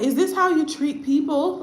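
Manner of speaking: high-pitched, incredulous question; indignant disbelief. Retold or imitated. Volunteer to cold charity people, appalled disbelief. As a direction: retell it as an appalled challenge.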